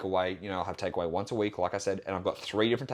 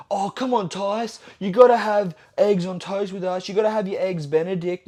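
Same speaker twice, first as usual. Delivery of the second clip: high, loud mock pleading; mock nagging in a raised pitch; pushy friend; 9 semitones higher, 7 dB louder than usual. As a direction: imitate an insistent friend badgering someone. Mimicking pushy friends, which nobody does.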